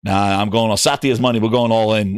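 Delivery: low, shrugging off the warning; low, careless gambler Phil. Retold or imitated. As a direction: brush it off like a gambler going all in. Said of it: "He's like".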